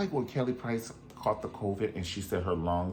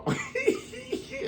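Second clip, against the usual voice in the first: high laugh. Real, not acted. Laughing at own list.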